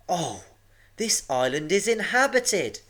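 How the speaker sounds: high-pitched dad covering his letdown; hiding disappointment. A narrator's reading. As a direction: try not to sound too disappointed, voice lifted. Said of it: "trying not to sound too disappointed".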